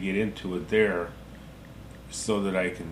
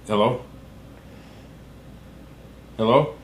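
loud, calling hello down the line; shouting into a phone; 4 dB louder than usual. Real, not acted. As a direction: raise your voice into a dropped call.

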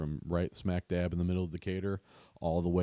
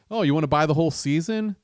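mock salesy offer; 7 semitones higher, 6 dB louder, faster than usual. Imagined website sales pitch.

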